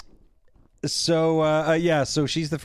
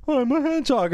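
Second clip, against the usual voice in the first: high, offhand hedgehog voice; breezy mock critter; casual hedgehog; 9 semitones higher, faster than usual. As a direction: shrug it off like a hedgehog. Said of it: "he's like".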